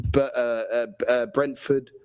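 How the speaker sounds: normal talking voice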